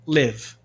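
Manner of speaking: LIVE with a short lax vowel, near "lev". Shown as a demonstration. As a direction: say live to rhyme with give. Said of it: "porém a pronúncia é le".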